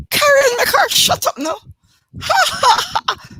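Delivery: very high, loud shriek of laughter; laughing scream. Retold or imitated. Poet voicing her mama at the joke: very high, loud laughing scream. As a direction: scream it through helpless laughter. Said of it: "she's screaming".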